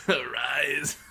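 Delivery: mock echo of the command; laughing copy of the villain's call; imitating anime command. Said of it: "Pretty good, huh?".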